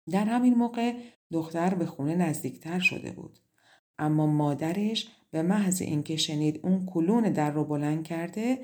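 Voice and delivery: usual speaking voice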